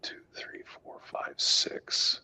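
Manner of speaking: counting in a whisper under the breath; murmured counting. Real, not acted. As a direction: whisper the numbers to yourself.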